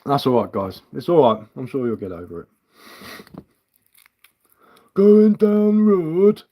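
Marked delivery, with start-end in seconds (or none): 4.9-6.4 high, loud, drawn-out mock Yorkshire; badly faked Yorkshire accent, stretched out slowly; poor Yorkshire accent. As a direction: belt out a hammy Yorkshire impression, pitched way up. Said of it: "my poor attempt at a Yorkshire accent".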